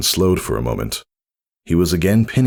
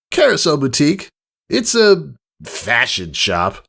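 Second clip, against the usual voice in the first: sour; 10 semitones higher than usual. Acted. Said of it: "as if they tasted sour".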